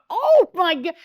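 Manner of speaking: high, loud acted astonishment; shocked exclamation. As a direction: re-enact the jolt of surprise. Retold shock at star in kitchen.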